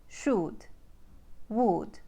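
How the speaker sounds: Ukrainian "should, would" with tight OO as in food; Ukrainian accent. Shown as a demonstration. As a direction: say "should, would" as "shood, wood", Ukrainian style. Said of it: "should and would become oo".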